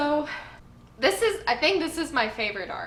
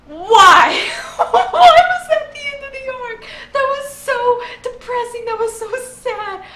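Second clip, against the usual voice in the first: high, loud cry of disbelief through laughter; real tearful protest, pitched high and loud; laugh-crying outburst; 12 semitones higher, 12 dB louder than usual. Real, not acted. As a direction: wail it, half laughing, half crying. Overcome with emotion over ending.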